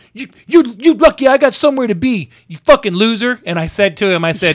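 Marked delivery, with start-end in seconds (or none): 0.1-3.4 high, loud stammering mock rage; pissed-off bluster. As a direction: imitate the angry man blustering as he backs off. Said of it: "he was pissed off at the world".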